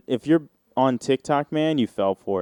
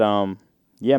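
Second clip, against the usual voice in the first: normal talking voice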